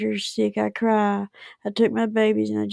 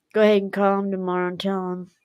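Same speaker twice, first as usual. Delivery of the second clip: normal talking voice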